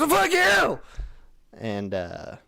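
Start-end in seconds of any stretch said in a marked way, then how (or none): 0.0-0.8 very high, loud shriek; screaming. Retold or imitated. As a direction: imitate a man screaming back, full force. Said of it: "Full on screaming".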